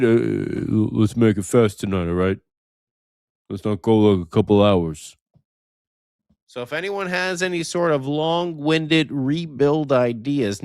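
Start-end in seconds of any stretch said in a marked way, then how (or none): 0.0-5.1 lower-pitched mock voice of a colleague; imitating co-host. Host quoting Sam Wu wanting a short show, low imitation. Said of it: "He said".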